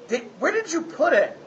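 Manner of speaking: put-on high, sly kid voice; coaxing child. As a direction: wheedle for candy like a little boy. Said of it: "He'll say something like".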